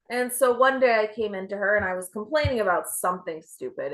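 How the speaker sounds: ordinary talk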